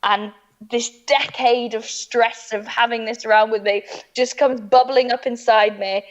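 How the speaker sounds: ordinary talk, nothing marked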